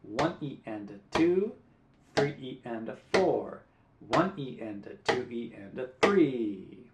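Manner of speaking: slow, even counting in time with the beat; teacher counting sixteenth notes aloud in time; rhythm counting. Shown as a demonstration. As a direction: count the syllables steadily to the guitar. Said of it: "speak the rhythm syllables".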